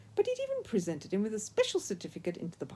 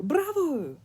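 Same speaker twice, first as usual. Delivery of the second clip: high Italian cheer, a bit louder; delighted cry; 8 semitones higher, 4 dB louder than usual. A narrator's reading. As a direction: cheer the pupil on. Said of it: "cried Signor Alberto".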